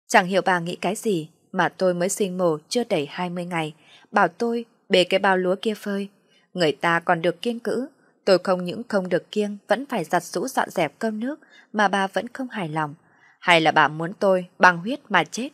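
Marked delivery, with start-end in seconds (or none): none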